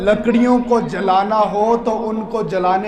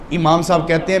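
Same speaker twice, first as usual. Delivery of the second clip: ordinary delivery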